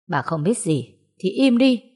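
ordinary delivery, nothing marked

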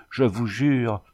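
supplicating stammer; begging to be believed; pleading voice. A narrator's reading. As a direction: swear it as a desperate plea. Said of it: "balbutia-t-elle d'une voix suppliante".

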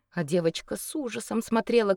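ordinary delivery, nothing marked

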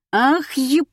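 high, loud outburst; yelled curse. A narrator's reading. Alisa yells back at accusing Morgunova, cursing loudly. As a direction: yell a cut-off curse. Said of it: "заорала Алиса".

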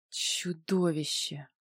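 slow, soft, menacing hush; sinister whisper. A narrator's reading. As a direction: hiss it slowly and darkly. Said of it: "зловещим шёпотом сказала она".

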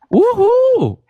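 high whooping cheer; excited cheer. Real, not acted. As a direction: whoop for the guest. Comemora a entrada da convidada. (grito de comemoração)

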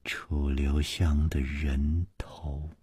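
slow, low, hushed syllable by syllable; name the price quietly, one word at a time; soft and deliberate, almost whispered. A narrator's reading. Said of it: "一字一字地说".

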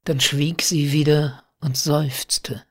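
usual speaking voice

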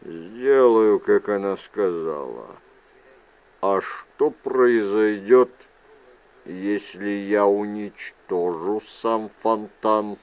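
low, slow, measured man. A narrator's reading. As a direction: drop the pitch well down and take it slowly. Voices Mender after his quiet reply.

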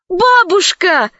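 high, drawn-out cry of surprise; exclaimed. A narrator's reading. Alice exclaims on seeing her grandma, high and slow. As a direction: call out in delighted shock. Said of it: "воскликнула Алиса".

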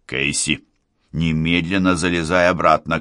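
plain reading voice

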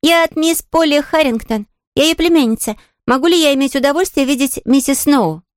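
put-on high, well-mannered little girl; polite young Pollyanna. Girl asking the maid to see Mrs Snow, polite. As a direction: introduce yourself in a high, polite child's voice.

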